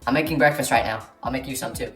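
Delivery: low, softening dad voice; scary, taciturn dad. Retold or imitated. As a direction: play the friend's intimidating dad, low and terse. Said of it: "but he scared me. He wasn't much of a talker".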